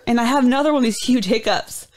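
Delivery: ordinary talk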